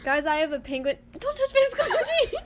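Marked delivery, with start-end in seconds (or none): 1.2-2.3 high, loud playful yell. Real, not acted. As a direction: yelp at her to stop. Snapping at friend grabbing toy.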